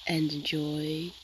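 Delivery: mock guided meditation. Gamer to her viewers during a quiet build, mock meditation whisper. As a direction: lull the viewers into fake calm, slowly.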